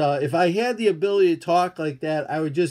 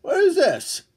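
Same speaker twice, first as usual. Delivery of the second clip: much higher, comic puzzled voice; mock puzzled squeak; 12 semitones higher than usual. Streamer to chat, holding up mail, a high mock-baffled squeak.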